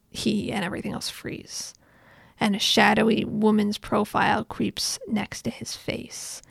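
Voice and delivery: soft, breathy whisper of suspense; hushed narration. A narrator's reading. Telling viewers the eerie freeze scene in a soft whisper. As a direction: whisper it like a ghost story. Eerie frozen moment in the story.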